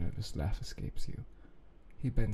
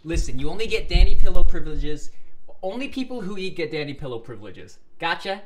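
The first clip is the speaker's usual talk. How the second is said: high, loud, insistent lecture; mock scolding; 8 semitones higher, 17 dB louder than usual. Real, not acted. Lecturing the character to eat first, mock scolding. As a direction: lay down the law playfully.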